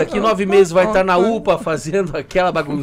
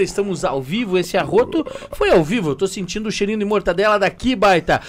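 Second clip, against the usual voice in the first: ordinary delivery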